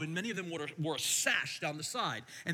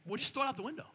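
sarcastic joke; 5 semitones higher, 3 dB quieter, much faster than usual. Retold or imitated. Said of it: "I was totally joking".

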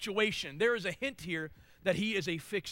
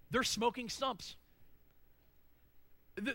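ordinary delivery

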